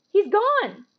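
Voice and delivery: high, loud burst of surprise; surprised cry. Acted. Harry startled at the empty card, high surprised cry. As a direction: gasp it out as the face vanishes.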